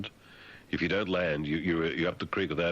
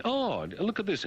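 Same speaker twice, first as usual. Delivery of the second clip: mock delighted surprise; 13 semitones higher than usual. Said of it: "you say".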